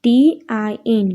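letters spelt out slowly one by one; slow letter-by-letter spelling. Shown as a demonstration. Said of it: "અહીં આપણને spelling આપેલા છે".

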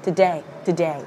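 "today" ending on a clear A vowel; low, careful repeats of "today"; pronunciation demo. Shown as a demonstration. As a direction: model "today" as tuh-DAY, falling. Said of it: "âm A".

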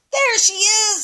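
high, bright discovery; excited baby bird. Acted. Grandma reading to James, baby bird spotting the boat, high and excited. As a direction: spot her and cry out high. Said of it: "said the baby bird".

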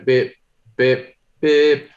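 high, loud spaced beeps; short electronic pips, voiced by mouth; imitated cue beeps. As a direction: mimic a countdown beep before dubbing. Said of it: "line going across the screen going".

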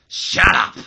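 loud furious yell of shut up; angry shout. Acted. Vince shouting down his son, furious yell. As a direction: bark it out at full volume. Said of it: "He says".

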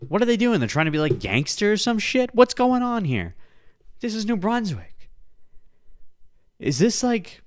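high, baffled disbelief; can't believe what he's seeing; incredulous. Real, not acted. Baffled by kids' videos.

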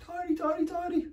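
high, soft little mouse voice; tiny mouse voice. Shown as a demonstration. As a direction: make it sound tiny and squeaky. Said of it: "a really tiny".

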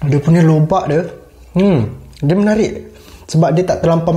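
talking while chewing; finishing a bite; mouth partly full. Real, not acted. Seen 0: Bite taken at -9; closed, working mouth between words, cheeks not bulging.

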